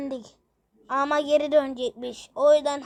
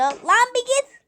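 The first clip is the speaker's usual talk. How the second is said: high loud get-lost; annoyed shout; 9 semitones higher, 6 dB louder, slower than usual. Acted. Character telling the other to go away, annoyed shout. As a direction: shoo him off loudly.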